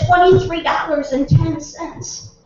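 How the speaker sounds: Edna's answer. Acted. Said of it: "And she said".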